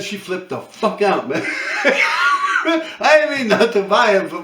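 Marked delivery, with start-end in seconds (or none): 1.5-2.6 high, unvoiced burst of laughter; high breathy laugh. Real, not acted. Laughing at the woman flipping out, a high wheezing laugh. Laughing at his own story. 2.6-4.3 laughing. Real, not acted. Said through laughter.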